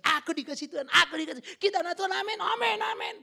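hammed-up eager amens, high and loud; mock smug believer. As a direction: parody smug churchgoers. Mocking overconfident Christians.

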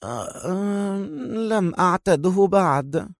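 lazy and drowsy on waking; relaxed yawn. A narrator's reading. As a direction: yawn into the words. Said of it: "تثاءبت في استرخاء وهي تنهض قائلة".

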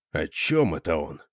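ordinary delivery, nothing marked

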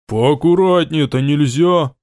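slow, rolled-r rasp from a dry mouth; dry-mouthed burr. A narrator's reading. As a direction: speak with a parched, burring tongue. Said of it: "картавя пересохшим языком".

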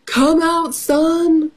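storybook cow calling someone out of hiding; mock shout. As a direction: sing out high in a playful shout. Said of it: "shouted cow".

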